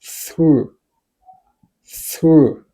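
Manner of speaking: "through" said alone, drawn out with falling pitch; pronunciation demo. Shown as a demonstration. Teacher showing learners how to say the word, "through" drawn out. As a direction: model "through" as one clear syllable, letting it fall. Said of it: "w ap pwononse mo sa".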